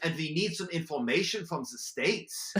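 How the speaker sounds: hammed-up German accent for a spy ploy; German businessman on the phone in a mock German accent. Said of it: "My go-to was German".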